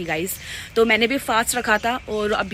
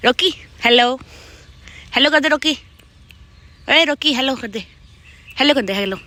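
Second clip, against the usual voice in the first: coaxing the dog; 5 semitones higher, 6 dB louder than usual. Real, not acted.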